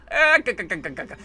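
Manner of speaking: weak Popeye-style chuckle; a rough try at Popeye's laugh. Retold or imitated. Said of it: "Not the best Popeye impersonation".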